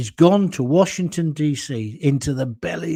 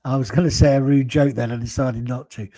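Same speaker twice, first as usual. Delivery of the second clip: normal talking voice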